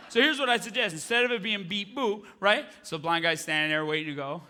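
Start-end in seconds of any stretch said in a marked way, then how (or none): none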